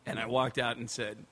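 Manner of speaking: ordinary talk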